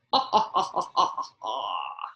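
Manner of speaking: low booming laugh of a lion; deep roaring laugh. Acted. The storyteller laughs as the lion. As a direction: laugh like a big happy lion.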